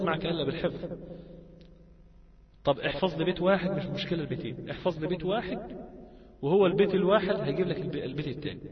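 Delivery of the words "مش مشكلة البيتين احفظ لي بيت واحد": usual speaking voice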